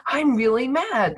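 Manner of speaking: mock-angry retort; mock mad. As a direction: act the riled-up upset person. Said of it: "You're generally gonna go".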